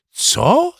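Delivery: high-pitched outraged "Co?"; grandma exclaiming in disbelief; shocked grandma. Acted. Grandma shocked at dad sending boy to school. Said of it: "zawołała Bunia".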